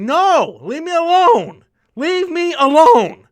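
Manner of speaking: high, loud pleading shout; retold panicked yell. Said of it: "I'm like".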